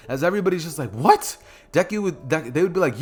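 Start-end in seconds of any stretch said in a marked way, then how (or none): 0.9-1.4 higher, louder startled question; mock disbelief. Classmates hear he knows All Might, mock astonishment. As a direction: blurt it as an astonished onlooker.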